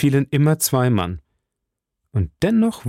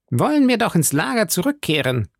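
high, blustering musketeer voice; exclaiming; 8 semitones higher than usual. A narrator's reading. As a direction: call out the suggestion, pitched well up. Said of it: "rief Porthos". (hoch ausgerufen, mit Figurenstimme)

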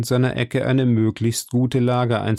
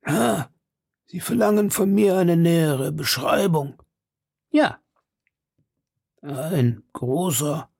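put-on old man; 3 semitones higher than usual. A narrator's reading. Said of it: "sagte der Greis".

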